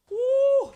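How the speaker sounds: high, loud whoop; loud cheering woo. Real, not acted. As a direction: cheer it out with both fists up. Cheer after donation.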